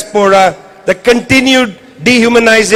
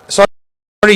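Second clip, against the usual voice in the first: normal talking voice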